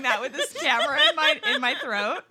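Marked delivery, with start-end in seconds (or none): none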